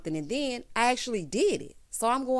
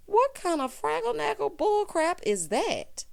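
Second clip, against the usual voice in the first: incredulous; 10 semitones higher than usual. Retold or imitated. Recapper voices her outraged reaction.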